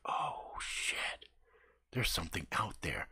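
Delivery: frightened hushed whisper; scared whisper. Retold or imitated. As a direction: whisper it like something's outside.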